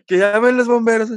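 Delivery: hammed-up emergency shout; mock alarm. Joking about fire.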